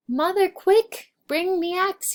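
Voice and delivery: very high, loud cry for help; urgent shout. Acted. Jack yells to his mother to bring the axe, loud and high. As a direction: yell it in a panic. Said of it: "he shouted".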